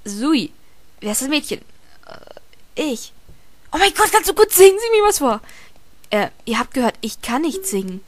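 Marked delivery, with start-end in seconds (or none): 3.7-5.4 loud, high, gushing; excited friend. Retold or imitated. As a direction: gush like an eager friend. Friend voiced excitedly in anecdote.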